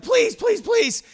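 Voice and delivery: high, loud, hurried pleas; hammed-up panic, imitating the victim; mock terrified begging. Dwarf begs his attacker, acted panicky plea.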